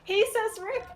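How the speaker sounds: high-pitched, climbing incredulous squeal; mock disbelief. Real, not acted. Repeats chat command in mock disbelief.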